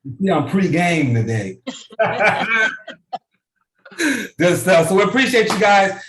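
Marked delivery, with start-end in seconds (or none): 1.6-3.2 high burst of laughter; real laugh. Real, not acted. Presenter amused by own pre-game remark, laughing.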